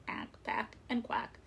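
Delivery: ordinary delivery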